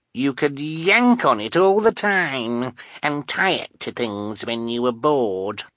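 put-on high, slow spoiled child; eager little brat; bratty girl voice. Carmelita suggests cruelty.

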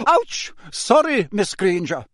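high, loud yelp settling into an apology; yelp, then hastily apologise; flustered exclamation, coming down in pitch; startled apology. A narrator's reading. Teacher skids, grabs Hermione, apologises.

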